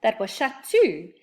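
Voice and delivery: ordinary delivery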